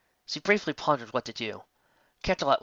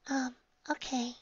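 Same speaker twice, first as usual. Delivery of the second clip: much higher, softer and slow, timid; nervously; 13 semitones higher, 7 dB quieter than usual. A narrator's reading. Fluttershy nervously agreeing to go inside, timid and soft. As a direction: hesitate shyly. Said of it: "Fluttershy said nervously".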